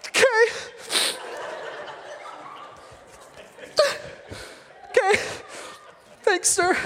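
small, high, strangled voice; stunned Haman. Acted. Stunned Haman reluctantly answers the king, high dismayed squeak. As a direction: squeak it out in dismay, with long pauses.

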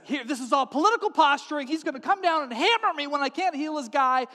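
high, agitated outburst; panicked ranting. Acted. King fearing war with Syria, freaking out. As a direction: rant as if you've been set up.

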